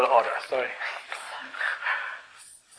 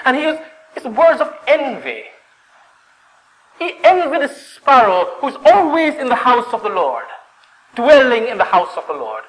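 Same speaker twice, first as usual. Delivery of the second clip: high, urgent sermon voice; fervent preaching; 10 semitones higher, 3 dB louder than usual. Real, not acted. Preacher explains envy of the temple sparrow, fervent. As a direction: press home the envy.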